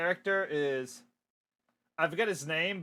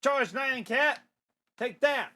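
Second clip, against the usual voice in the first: raised, punchy fighter's shout; attack command; 7 semitones higher, slower than usual. Acted. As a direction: call out a cartoon attack. Comic character launches attack.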